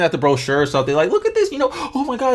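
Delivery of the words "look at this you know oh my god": excited. Retold or imitated. Girlfriend excited voiced in story.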